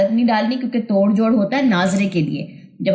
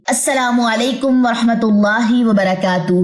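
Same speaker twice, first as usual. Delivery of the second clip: ordinary talk, nothing marked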